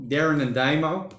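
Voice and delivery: ordinary delivery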